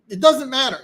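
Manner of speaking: emphatic. Shown as a demonstration. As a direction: hit "doesn't" hard and high. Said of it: "repeats the phrase with new emphasis".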